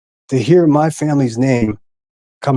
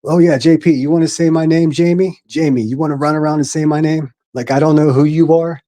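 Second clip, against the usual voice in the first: sneering call-out, pitched up; mocking taunt; 4 semitones higher than usual. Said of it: "called that J P woman the C word".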